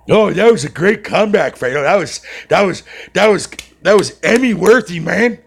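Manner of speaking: high, loud mock admiration; sarcastic praise. Streamer mocking Savage's weak comeback, sarcastic. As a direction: overdo the praise so it stings.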